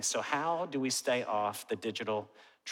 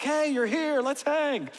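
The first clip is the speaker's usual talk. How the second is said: high cheerful voice; 13 semitones higher, 5 dB louder, slower than usual. Retold or imitated. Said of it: "it's like".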